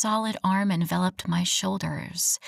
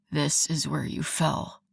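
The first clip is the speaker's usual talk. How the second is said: rough; 4 semitones lower, 4 dB quieter than usual. Acted. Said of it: "he said roughly".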